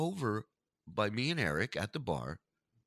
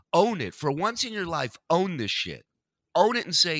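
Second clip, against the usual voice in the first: louder, higher rant; fired-up louder challenge; demanding; 6 semitones higher, 5 dB louder than usual. Real, not acted. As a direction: call him out hard. Ranting at John.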